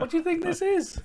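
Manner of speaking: high squeaky indignant mimicry; a shrill high parody of an outraged complaint; mock outraged voice. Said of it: "But it'd be like".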